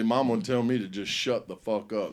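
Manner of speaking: ordinary talk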